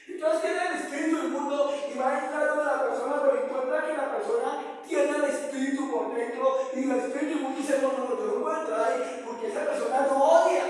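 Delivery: loud, high, driving sermon delivery; fervent preaching. Acted. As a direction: preach it at full force to the congregation. Preaches at full force.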